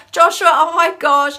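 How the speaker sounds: high, loud delighted greeting; light up at a friend; excited greeting. Real, not acted.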